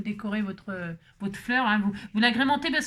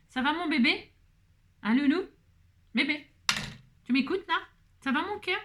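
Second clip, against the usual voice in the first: high, sing-song pet talk; 6 semitones higher than usual. Real, not acted. Checking on her baby, high cooing. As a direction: coo sweetly up high to a little one.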